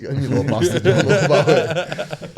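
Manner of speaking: high, loud, slow laughing words; laughing at your own punchline. Real, not acted. Fighter laughing about his daughter poking his face.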